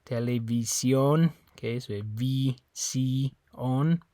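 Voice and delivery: "visión" slow, with I said as E; Spanish visión; Spanish televisión. Shown as a demonstration. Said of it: "you have the I's in this word as well".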